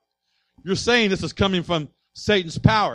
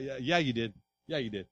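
knowing quiet retort; 7 semitones lower, 8 dB quieter than usual. Acted. Pastor rebuts a sugarcoated excuse.

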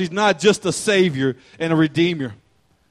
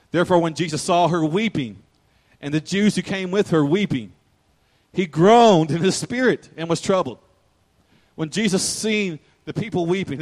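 ordinary talk

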